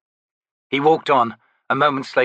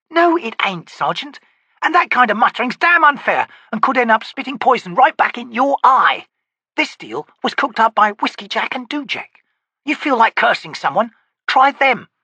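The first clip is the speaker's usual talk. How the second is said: sharp, loud rebuke; scolding corporal; 13 semitones higher, 5 dB louder than usual. Acted. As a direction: put him in his place. Pika scolds.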